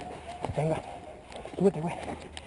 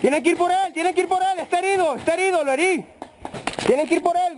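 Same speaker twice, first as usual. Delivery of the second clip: excited urging; 7 semitones higher than usual. Real, not acted. Urging team after hitting enemy.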